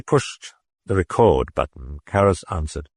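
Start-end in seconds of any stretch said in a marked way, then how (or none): none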